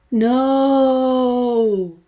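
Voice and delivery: hoge, harde kreet; scream. A narrator's reading. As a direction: schreeuw het uit als het monster geraakt wordt. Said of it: "screamed Sir P".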